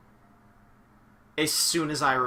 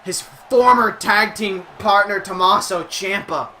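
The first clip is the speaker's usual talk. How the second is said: higher, louder announcer call; wrestling play-by-play; 6 semitones higher, 12 dB louder than usual. Acted. Streamer calling the match for the stream, excited play-by-play. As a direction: sell every move like a ringside announcer.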